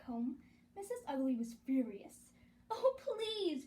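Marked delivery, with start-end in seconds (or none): 2.7-3.7 high pleading wail. A narrator's reading. Gertie begging her mother, wailing. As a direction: beg like a child in tears. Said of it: "Gertie wailed".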